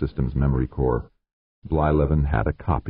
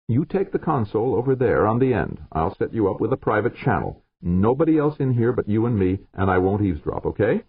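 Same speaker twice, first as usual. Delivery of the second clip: higher woman's voice, conceding; more reasonable; 8 semitones higher than usual. Acted. Controller offering George a private console, more reasonably. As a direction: give in, reasonably, a bit higher. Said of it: "then said more reasonably".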